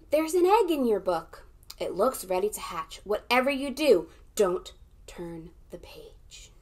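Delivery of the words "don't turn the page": slow, soft whisper; whispered warning. Acted. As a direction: warn them in a hush. Warning children teasingly.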